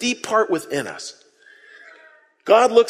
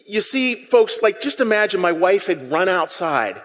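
normal talking voice

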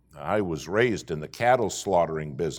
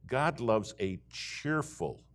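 ordinary delivery

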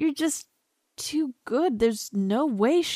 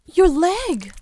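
alarmed cry; 5 semitones higher, 7 dB louder than usual. Acted. Sees the cut.

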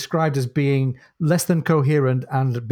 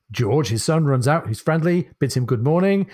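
normal talking voice

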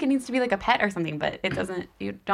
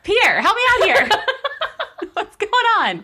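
laughing; 4 dB louder than usual. Real, not acted. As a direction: laugh through the confusion. Still confused by the clue.

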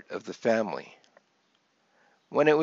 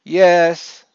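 loud, higher shouted answer; kids' raised-voice reply; called-back reply; 6 semitones higher, 11 dB louder than usual. A narrator's reading. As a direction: holler back across the house. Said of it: "they would reply".

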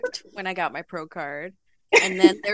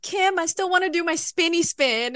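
excited plea; 9 semitones higher than usual. Retold or imitated. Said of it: "and I decided".

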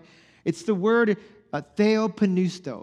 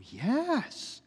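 soft, high-pitched amazed yes; hushed, hammed-up amazement; mock awed confirmation; 5 semitones higher, 8 dB quieter than usual. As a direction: breathe it out softly in feigned wonder. Continues acted astonished discovery.

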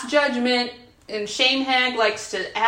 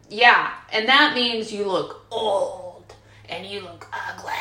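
shame hag; 3 semitones lower than usual. Acted. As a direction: stretch the cruel words like a sneering hag. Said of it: "the shame hag would be like".

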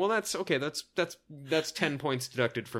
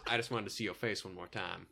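put-on low, soft Southern accent; movie-star quote in a low Southern voice; 6 semitones lower, 8 dB quieter than usual. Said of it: "he's got a southern accent".

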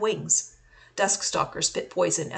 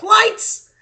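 high, very loud shout; yell; 13 dB louder than usual. A narrator's reading. Kenton steps into the clearing and orders the lights on, yelling. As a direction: shout it out as a command.

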